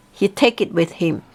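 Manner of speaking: usual speaking voice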